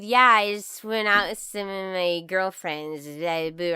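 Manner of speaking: imitating a tipsy teenager; drunk girl. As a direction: slur it lazily, words running together. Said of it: "she responds with".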